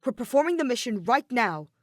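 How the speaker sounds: robot giving a loud, urgent order; hurried order. Acted. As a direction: push him to hurry. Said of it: "Kiba ordered him hurriedly".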